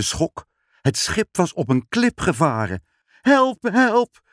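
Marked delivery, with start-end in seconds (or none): 3.2-4.2 much higher and louder, a desperate cry; shouting for help. A narrator's reading. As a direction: scream for help as the ship hits the rock. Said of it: "schreeuwde Piet".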